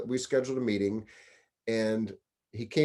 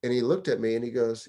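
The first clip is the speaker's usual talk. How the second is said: ordinary talk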